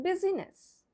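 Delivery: BUSYNESS in three syllables, biz-ih-ness; spelling pronunciation. Shown as a demonstration. Teacher shows the wrong way to say business, BUSYNESS as biz-ih-ness. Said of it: "if we go by the spelling".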